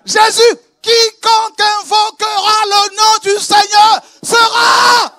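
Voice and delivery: loud and high, rising to a held repeated shout; building preaching cry. Acted. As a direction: climb to a peak, hammering each word.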